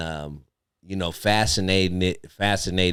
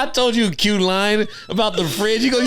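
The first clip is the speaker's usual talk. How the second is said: normal talking voice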